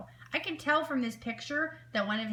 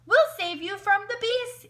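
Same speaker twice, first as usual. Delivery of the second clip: high, loud heroic yell; 12 semitones higher, 5 dB louder than usual. A narrator's reading. Mister Cat rushing to rescue the wolf, yelling. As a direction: call out bravely to the rescue. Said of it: "yelled Mister Cat".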